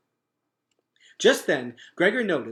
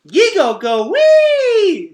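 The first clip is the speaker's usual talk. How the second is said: put-on high, slow, gleeful toddler squeal; a little girl loving the ride; squealing baby voice; toddler delight; 13 dB louder, much slower than usual. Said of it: "A bright giggle came".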